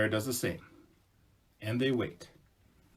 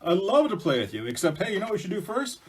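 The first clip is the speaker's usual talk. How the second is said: loud, showy bear, pitched up; boastful bear; 6 semitones higher, 6 dB louder than usual. Acted. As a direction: show off proudly, swelling on the praise. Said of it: "And Bear goes".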